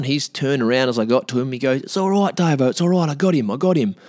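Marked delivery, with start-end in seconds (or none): 1.8-3.9 high, keyed-up friend's voice; urgent reassurance. Retold or imitated. Friend reports back after the chase, urgent reassurance. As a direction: act out a mate insisting it's handled.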